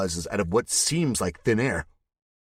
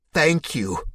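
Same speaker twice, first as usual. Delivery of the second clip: high, louder tearful thanks; overwhelmed thanks; 8 semitones higher, 4 dB louder than usual. A narrator's reading. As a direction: gush it out, close to tears. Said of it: "tears in his eyes".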